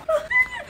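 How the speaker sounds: high shrieking laugh. Real, not acted. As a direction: crack up at the joke. Mishearing accident joke.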